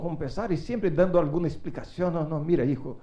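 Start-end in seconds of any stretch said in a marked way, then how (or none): none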